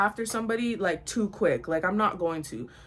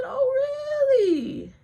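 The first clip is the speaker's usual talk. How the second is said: high drawn-out squeal sliding back down; mock astonishment; 4 dB louder, much slower than usual. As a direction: start way up high, then slide down knowingly. Repeats her sarcastic reaction.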